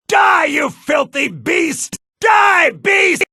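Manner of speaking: high loud war shout; battle cry. Acted. Fighter strikes at a beast, shouting. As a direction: bellow at the beast.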